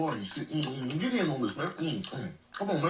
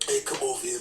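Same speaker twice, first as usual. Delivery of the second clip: ordinary talk, nothing marked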